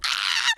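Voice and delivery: high, sudden squeal of joy; excited scream. Real, not acted. Girl screaming at her crush's confession text. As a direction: let out a shriek reading the text. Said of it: "I started bawling".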